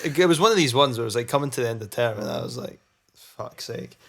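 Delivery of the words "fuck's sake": muttered curse. Retold or imitated. Needing to fart in class.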